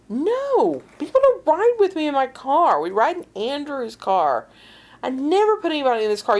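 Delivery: high-pitched, adamant protest; emphatic. Retold or imitated. Worried woman denying anyone rode in her car, emphatic. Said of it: "she's emphatic. Absolutely emphatic".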